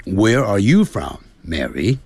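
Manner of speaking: American English with curled Rs; unhurried American pronunciation, Rs sounded; American accent. Shown as a demonstration. Teacher models John's question to Mary in American English. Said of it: "那在美式英语就是".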